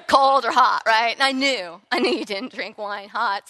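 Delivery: ordinary talk, nothing marked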